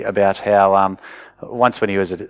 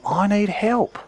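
put-on child's plea; 9 semitones higher, slower than usual. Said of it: "That attitude is".

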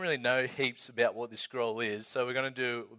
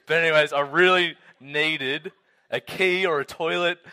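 louder emphatic retelling; 6 semitones higher, 9 dB louder than usual. Retold or imitated. Youth leader retelling his desperate need, louder. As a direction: push it out louder, exasperated.

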